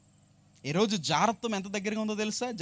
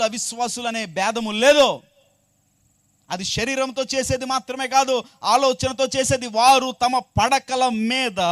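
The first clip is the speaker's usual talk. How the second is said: loud, raised sermon delivery; full-force preaching; 4 semitones higher, 9 dB louder than usual. Real, not acted. Preacher warns listeners sin respects no relationship, full-force preaching. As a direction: drive each phrase home to the congregation.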